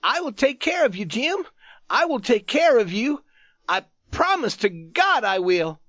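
high, loud, urgent promise; excited cry. A narrator's reading. Youth promising his wounded friend help, crying excitedly. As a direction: cry it out desperately, pitched high. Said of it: "cried out to him excitedly".

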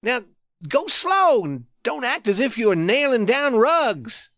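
high-pitched cross complaint; angry. A narrator's reading. Wounded youth scolding his rough-handed friend, angrily. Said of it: "he said angrily".

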